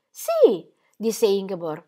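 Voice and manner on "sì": sì molto alto e acuto; agitazione. Acted. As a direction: esplode in un sì agitato. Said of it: "in preda a un'enorme agitazione". (la moglie agitata risponde con un sì acuto)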